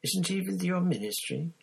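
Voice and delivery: put-on curious question from a wary young man; Krum, curious. A narrator's reading. Krum's voice.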